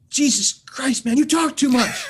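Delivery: put-on high, loud fed-up kid; acted exasperated yell; exasperated outburst. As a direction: blow up at someone who won't stop talking. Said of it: "he goes like this".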